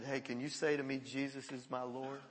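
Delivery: low, soft coaxing; gentle request. Retold or imitated. Pastor asking the young man to confess, gentle request. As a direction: ask it quietly and low.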